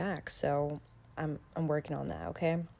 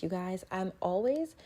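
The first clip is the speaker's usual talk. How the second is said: ordinary delivery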